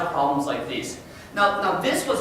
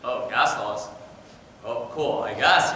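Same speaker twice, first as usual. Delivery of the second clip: unimpressed mom; 6 semitones lower, slower than usual. Retold or imitated. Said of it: "It's like".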